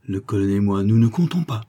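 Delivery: ordinary delivery, nothing marked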